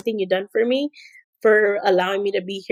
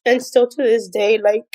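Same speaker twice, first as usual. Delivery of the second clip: real tearful voice, halting with pauses; crying; 4 semitones higher, 3 dB louder than usual. Real, not acted. Assistant thanking her boss, starting to cry. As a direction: push through the tears, breaking off to apologise. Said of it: "I don't know why I'm crying".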